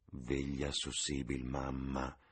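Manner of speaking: ordinary delivery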